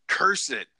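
mock exasperation. Mock cursing his weakness.

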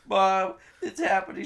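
hammed-up kid yelling in panic; mock panic shout. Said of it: "as soon as you're like".

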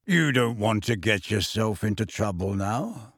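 deep, slow, calm warning; slow deep bass caution; low unhurried voice. Acted. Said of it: "in his deep, slow voice".

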